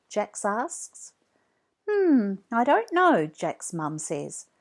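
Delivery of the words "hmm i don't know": higher, louder doubtful mother; hesitant mum. A narrator's reading. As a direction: hum it out, unsure, as the mum.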